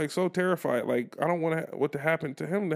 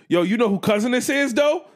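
loud, higher, laughing announcement; hyped bus kid; 8 semitones higher, 7 dB louder than usual. Retold or imitated. Kid tells the bus whose cousin he is, loud and high. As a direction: stir up the crowd with the news.

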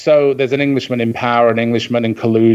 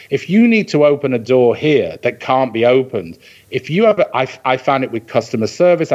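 normal talking voice

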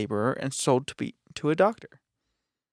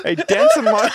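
laughing; 8 dB louder than usual. Real, not acted. Collapses laughing.

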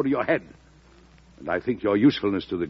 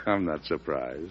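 wry chuckle; 6 semitones lower, 9 dB quieter, slower than usual. Acted. President laughs at party news.

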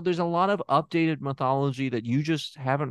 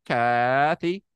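Kathy said louder with a rising end; questioning name; 4 dB louder than usual. Shown as a demonstration.

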